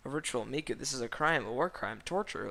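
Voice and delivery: ordinary delivery, nothing marked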